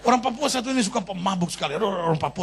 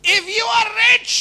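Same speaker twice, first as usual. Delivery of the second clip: higher and louder flourish; preacher repeats English line, higher and louder; grand preacher's English; 7 dB louder, slower than usual. Acted. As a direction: proclaim it to the room.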